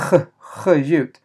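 H said as a raspy "kh", twice; throaty kh. Shown as a demonstration. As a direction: scrape both H sounds at the back of the throat. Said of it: "som ett X, alltså H".

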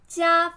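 加 high and level; flat tone. Shown as a demonstration. Teacher breaking down attend for learners, level tone on 加. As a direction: hold 加 on a steady high pitch.

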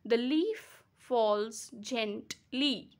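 the leaf falls gently in slow separate beats; gently broken as gent-ly; split into syllables. Shown as a demonstration. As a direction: pause through "the leaf falls gently" beat by beat. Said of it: "I repeat".

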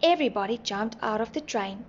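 ordinary delivery, nothing marked

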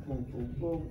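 usual speaking voice

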